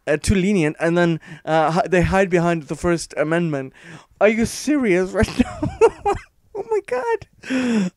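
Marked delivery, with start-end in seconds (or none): 4.2-5.6 incredulous laugh. Real, not acted. Incredulous at the article. 6.5-7.9 very high, slow exasperated groan. Real, not acted. Vlogger reacting to the article, drawn-out high exasperation.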